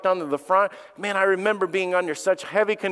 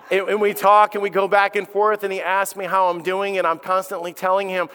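ordinary talk, nothing marked

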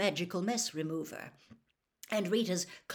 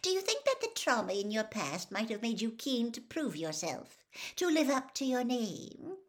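coaxing gossip reporter, sugary; a reporter wheedling a boy into a scoop; Rita Skeeter voice; 5 semitones higher than usual. Acted. Reporter Rita coaxes Harry, lightly voiced and wheedling.

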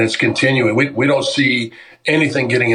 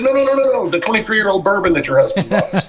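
excited protest; 8 semitones higher than usual. Retold or imitated. Guy protesting, retold.

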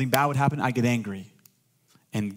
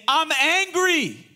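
angry; 5 dB louder, much slower than usual. Retold or imitated. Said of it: "Instead of saying".

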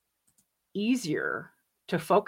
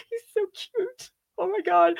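swooning squeal; 12 semitones higher than usual. Real, not acted. Gushing to her community about Cumberbatch, high swooning squeal. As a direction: melt over how cute he is.

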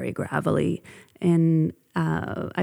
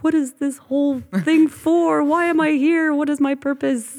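anxious inner voice; 9 semitones higher, 4 dB louder than usual. Retold or imitated. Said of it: "all of a sudden you're like".

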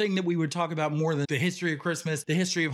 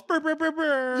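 high trilled "brr" radio effect; mock jingle trill; radio intro effect; 9 semitones higher than usual. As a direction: imitate a radio sting with rolled brrs. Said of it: "The intro?".

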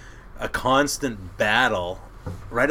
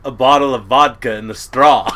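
quoting Harold again, louder and flat-out; loud deadpan repeat; 3 semitones higher, 13 dB louder than usual. Retold or imitated. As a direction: hit the punchline hard. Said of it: "He goes".

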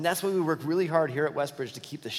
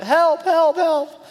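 high, loud comic cry of "Help, help"; mock scream for help; 11 dB louder, much slower than usual. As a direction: yell "help" like a panicked man grabbed by a lion. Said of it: "he starts screaming out".

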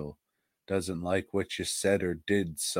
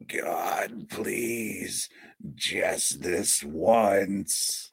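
strained, drawn-out pleading prayer; Jordy Verrill; much slower than usual. Acted. Host dreading brain disease begs for luck, pleading. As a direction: beg slowly, words stretched out in mock desperation. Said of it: "I'm gonna be like Jordy Verrill".

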